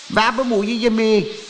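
high, put-on blustering voice; huffy braggart character; indignant major's cry. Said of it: "riep majoor Klets".

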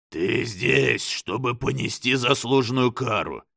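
stern demon judging. A narrator's reading. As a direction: pronounce judgment as Satan. Satan speaks.

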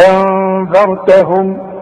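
slow, with ghunna and tongue out on ذال; tajweed recitation. Shown as a demonstration. Teacher showing hide and nasalize, slow recitation. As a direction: say أنذرتهم slowly, hiding the نون and nasalizing, tongue out for the ذال. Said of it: "اخفي وغن".

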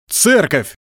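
громкий высокий возглас; прогреми на всю толпу; громовой голос. A narrator's reading. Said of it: "прогремел голос". (прогреметь)

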